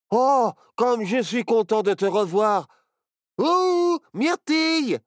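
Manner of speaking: excited shouting of a name; crying out. Acted. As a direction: call out to a friend across the room. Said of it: "cria Barnabé".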